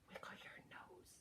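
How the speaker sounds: whispered nose wiggle; playful whisper to kids. Pretend. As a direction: drop to a whisper for the game. Wiggle game, whispered line.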